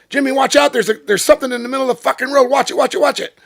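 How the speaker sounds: high, loud, fast alarmed shouting; urgent CB warning. Retold or imitated. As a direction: yell a warning to a driver ahead. Friend warning over the CB.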